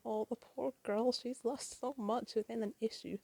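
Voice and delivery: ordinary delivery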